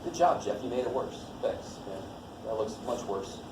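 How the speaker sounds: mock praise in a low-key mutter; sarcastic student. Student reacting to teacher's messy algebra, imitated sarcasm. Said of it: "you look at this and go".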